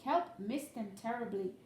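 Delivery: usual speaking voice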